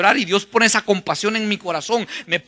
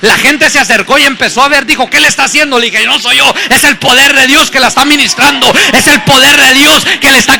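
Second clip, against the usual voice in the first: loud, higher-pitched fervent preaching; predicación a toda voz; 4 semitones higher, 8 dB louder than usual. Acted. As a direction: preach the testimony at full force, driving each phrase out. Preaches the testimony at full force. (predicación ferviente a gritos)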